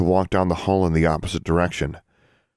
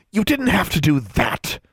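hissed; 11 semitones higher than usual. A narrator's reading. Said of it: "she hissed".

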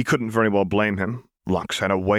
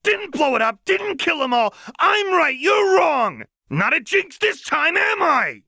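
much higher, louder hysterical yelling; frantic shouting; 3 dB louder than usual. Acted. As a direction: scream yourself hoarse. Jinx screaming at imagined Milo.

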